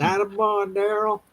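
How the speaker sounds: put-on lightly slurred drunk guy voice; a hint of a drunk in the background. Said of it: "sound like some drunk guy".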